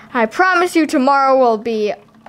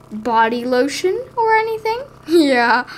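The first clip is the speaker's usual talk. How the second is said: normal talking voice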